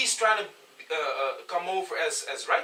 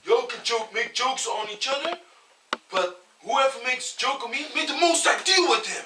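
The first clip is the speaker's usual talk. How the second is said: higher and louder, building to a laughing proclamation; mock solemn decree; 6 semitones higher, 6 dB louder than usual. Retelling the camp member's warning, a laughing mock decree. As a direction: proclaim the curse like a preacher, cracking up. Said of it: "he's also said, like".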